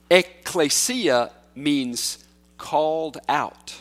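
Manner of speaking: "Ekklesia" spelled out slowly as eh-KLAY-see-ah; slow teaching word. Shown as a demonstration. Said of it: "the New Testament word is ekklesia".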